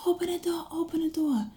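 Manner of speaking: high, urgent call at held-back volume; retold yelling. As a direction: squeal out a restrained imitation of yelling for the door. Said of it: "I was yelling".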